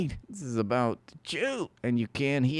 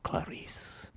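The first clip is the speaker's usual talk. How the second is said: breathy unvoiced whisper of Clarice; whispered name; 13 dB quieter than usual. Lecter whispering Clarice's name to her.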